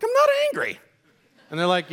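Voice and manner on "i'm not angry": high-pitched mock outburst; hammed-up furious denial, very high; indignant denial. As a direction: protest angrily in a comically high yell. Comic angry denial to his kids.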